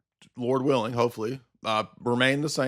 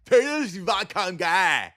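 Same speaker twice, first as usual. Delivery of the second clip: slow, higher dopey parody voice; mock bear comedy; 5 semitones higher, slower than usual. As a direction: drag it out like a dim fan. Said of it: "that's my impression of bear comedy".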